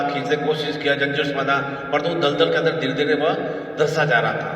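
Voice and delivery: ordinary talk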